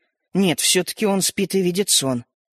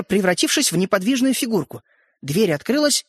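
ordinary delivery, nothing marked

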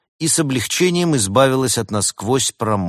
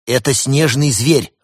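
higher, louder impatient exclamation; blurted out; 4 semitones higher, 6 dB louder than usual. A narrator's reading. Cat tired of hesitations reveals the secret, blurting. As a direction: blurt it out, fed up with waiting. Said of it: "выпалил Константин, которому надоели заминки".

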